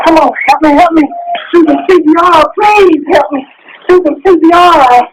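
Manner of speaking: high-pitched cries for help; real panicked begging; desperate pleading. Real, not acted. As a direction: beg someone to act now, voice high with distress. Begging arriving person for help.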